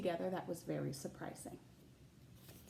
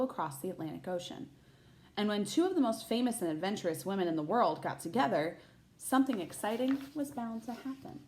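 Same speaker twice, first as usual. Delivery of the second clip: put-on bright, lively read-aloud voice for children; picture book narration; storybook reading; 3 semitones higher, 4 dB louder than usual. A narrator's reading. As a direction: read it like story time for a class.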